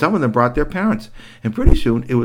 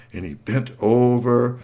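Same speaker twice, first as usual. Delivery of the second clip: plain reading voice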